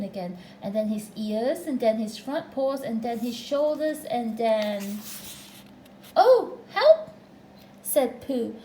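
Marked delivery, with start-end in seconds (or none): 6.2-7.1 high, loud cry for help; startled high yelp; alarmed. A narrator's reading. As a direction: call out in sudden dismay. Pooh stuck in the hole.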